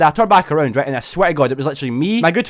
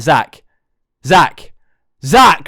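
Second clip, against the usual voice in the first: calling out; 7 dB louder, much slower than usual. Retold or imitated. Calling to an unresponsive friend.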